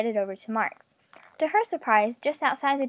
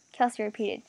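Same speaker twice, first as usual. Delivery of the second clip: ordinary delivery, nothing marked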